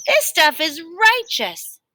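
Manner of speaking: high, slow, gushing with pleasure; gurgling delight. A narrator's reading. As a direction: savor every word like a foodie. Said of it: "he gurgled".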